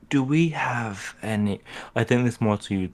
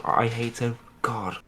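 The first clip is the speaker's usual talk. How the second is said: usual speaking voice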